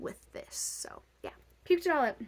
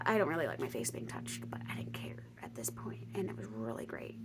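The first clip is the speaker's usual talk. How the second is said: whisper; 11 semitones lower, 8 dB quieter than usual. Real, not acted. Whispering a confession to the viewers. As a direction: drop to a breathy unvoiced whisper. Whispered aside about face touching.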